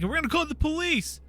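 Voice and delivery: high-pitched imitation of indignant security; mock threat. Security guards threatening the runners.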